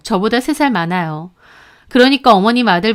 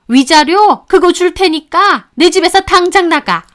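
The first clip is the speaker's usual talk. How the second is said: higher and louder, contemptuous mother-in-law scolding; tongue-clicking scolding; 6 semitones higher, 5 dB louder than usual. A narrator's reading. Mother-in-law throwing daughter-in-law out of the house, scornful scolding. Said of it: "혀를 차면서 저를 나무라시더군요".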